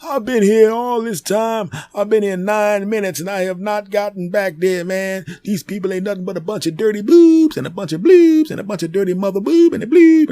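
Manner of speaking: high, loud cursing voice; foul mouthed granny. Retold or imitated. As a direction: rant high and shrill-free, full tilt. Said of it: "cursing out the nurses".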